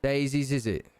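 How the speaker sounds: lightly voiced Cockney deity; Cockney with tag questions tailing down; Cockney British accent. Deity commiserates about the future in a Cockney accent. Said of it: "kind of Cockney British accent".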